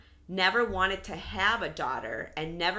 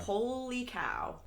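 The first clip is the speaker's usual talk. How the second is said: hushed disbelief; 6 semitones higher, 5 dB quieter, slower than usual. Retold or imitated. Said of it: "I was like".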